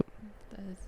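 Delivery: soft unvoiced whisper. Real, not acted. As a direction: breathe it in a whisper. Whispered agreement.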